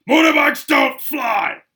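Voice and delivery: high, loud, slow furious bellow; yelled. A narrator's reading. Uncle yelling at his nephew from the driver's seat, high and loud. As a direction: roar it at the boy in the back seat. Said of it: "yelled at Harry".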